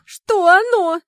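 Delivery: high, loud distressed question; nearly sobbing. A narrator's reading. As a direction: wail it, on the edge of tears. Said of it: "чуть не рыдала ведьма".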